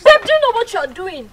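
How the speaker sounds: wife screaming at husband over the slap; shocked shout. Acted. Wife scolds husband for slapping.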